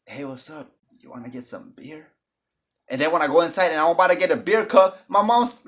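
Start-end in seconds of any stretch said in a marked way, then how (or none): 0.1-2.1 put-on soft, smooth lightly Spanish-accented flirt; a light suave Spanish accent, playing it smooth. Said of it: "I got the accent".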